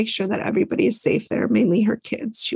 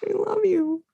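high, soft, mock-swooning sigh; 12 semitones higher, 6 dB quieter than usual. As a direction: gush over him like a smitten fan. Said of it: "And I'm like".

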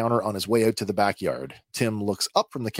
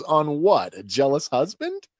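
higher, louder teasing jab; laughing at him; 5 semitones higher, 3 dB louder than usual. Retold or imitated. Bix teases Cassian about his fall, retold as mocking amusement. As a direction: poke fun at him, lightly acted. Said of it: "Bix laughs at him when she says".

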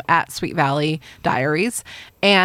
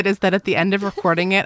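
ordinary delivery

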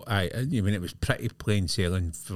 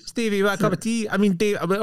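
much higher and quicker, fussing hostess; high woman's voice offering tea; 12 semitones higher, 3 dB louder, faster than usual. Retold or imitated. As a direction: chirp it up high and fast, then slip on the name.

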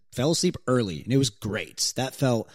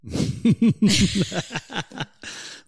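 burst of laughter; 10 semitones higher, 3 dB louder than usual. Real, not acted. Cohost cracks up at bluntness.

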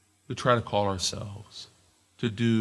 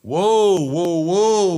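loud, high drawn-out whoa; friend's gentle brake; 8 semitones higher, 8 dB louder, much slower than usual. Retold or imitated. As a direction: rein him in like a friend. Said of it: "And he would always say".

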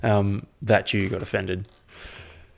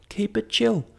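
high squeaky keep it chill; 11 semitones higher than usual. Shown as a demonstration. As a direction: jump way up in pitch on keep it chill. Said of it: "Just keep it chill".

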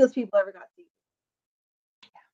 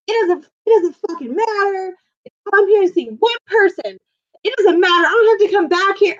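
higher-pitched worked-up protest; retold indignant rant; 7 semitones higher than usual. Friend telling staff she needn't return, indignant. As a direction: act out a fed-up fan's tirade.

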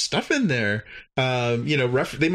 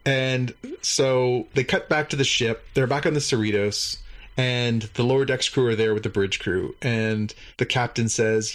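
ordinary talk

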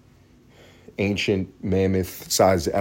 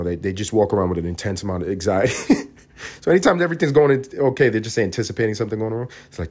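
ordinary delivery

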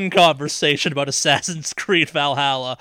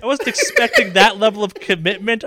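laughing; 6 semitones higher, 4 dB louder than usual. Real, not acted. Laughing at friend's rant.

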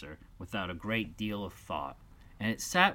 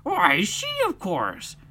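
dodo voice; 7 dB louder, slower than usual. Acted.

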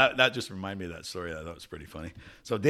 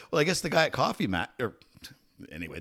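ordinary talk, nothing marked

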